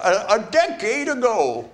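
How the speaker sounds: put-on high, creaky old-timer telling a tale; old man voice. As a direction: play it like a grandpa starting a story. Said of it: "I should do the old man voice".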